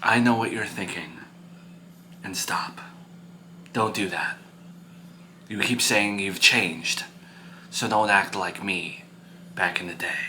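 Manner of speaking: low, soft, spaced-out warning; quietly warning parent. Acted. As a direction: caution him quietly and firmly. Parent warns son.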